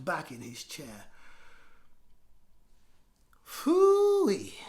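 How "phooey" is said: much higher, whispered phooey; disgusted phooey. Acted. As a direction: scoff under your breath. Henry disgusted aside.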